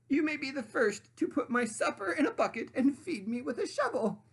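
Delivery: high, loud demanding sandwich voice; high bossy sandwich lady voice. Acted. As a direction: play the pushy sandwich, high and grand. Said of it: "she crowed".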